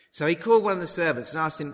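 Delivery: usual speaking voice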